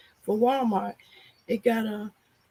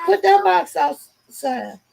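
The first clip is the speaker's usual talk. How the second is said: calling out; 6 semitones higher, 6 dB louder, slower than usual. Real, not acted. Calling to child about the box.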